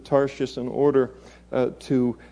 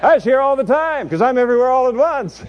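high, loud, comic greeting; playful voice of God, pitched way up; the Lord greeting Jonah; 10 semitones higher, 5 dB louder than usual. Acted. God surprising the runaway Jonah on arrival, high comic greeting. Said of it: "and he says".

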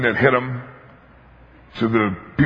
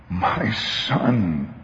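heartbroken father; 4 semitones lower, 5 dB quieter than usual. Acted. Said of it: "broken heart of the father who goes".

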